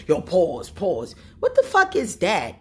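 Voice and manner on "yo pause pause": mocking dude. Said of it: "men that don't go around saying all that".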